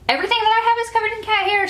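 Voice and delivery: fast, high exasperated rush. Real, not acted. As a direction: squeal it out in disbelief. Exasperated outburst.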